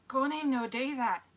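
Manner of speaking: put-on slow Scottish accent. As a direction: say it like a Glaswegian. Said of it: "instead of saying why, they say how".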